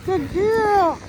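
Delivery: high, sing-song praise for the dog. Real, not acted. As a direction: coo it up high like praising a puppy.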